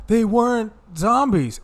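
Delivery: high, drawn-out mock protest; high-pitched retold objection; acted protest. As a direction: imitate a dismayed movie line, high and slow. Acted movie line, told laughing.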